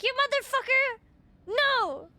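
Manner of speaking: high, loud outburst at the screen; yelling at the TV. Retold or imitated. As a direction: yell at the TV like the show betrayed you. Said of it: "yelling at my T V".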